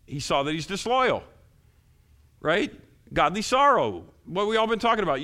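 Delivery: loud, high-pitched preacher driving it home; fervent preaching. Real, not acted.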